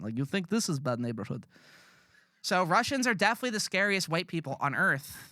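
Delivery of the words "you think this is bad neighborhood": put-on mild Russian tough-guy accent, a bit lower. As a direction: retell the comedian's Russian accent line as a mocking impression. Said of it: "a, a Russian accent".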